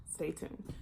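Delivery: whispered. Real, not acted. Sign-off line in an intro.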